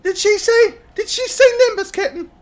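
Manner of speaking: put-on very high, loud, quick child voice; excited little girl. Granddaughter overhearing her idol's name, excited. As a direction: pipe up like a thrilled kid.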